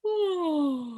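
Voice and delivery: a big open yawn on ooh. Shown as a demonstration. Coach shows the group, a wide yawning ooh. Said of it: "I just want you to yawn".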